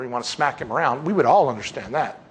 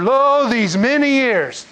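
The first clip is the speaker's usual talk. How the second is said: raised pitch, louder and drawn out; indignant reading; 9 semitones higher, 5 dB louder, much slower than usual. Acted. Older son answers his father, indignant. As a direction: build to a loud, aggrieved declaration.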